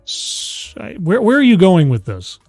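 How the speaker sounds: ordinary talk, nothing marked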